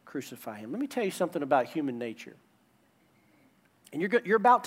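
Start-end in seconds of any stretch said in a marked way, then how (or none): 0.0-0.7 mock crowd cry, lower and trailing away softer; retold chant sinking to a low, soft murmur; understated crowd cry. Preacher retelling the crowd's call against Jesus, understated and hushed. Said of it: "they're crying out".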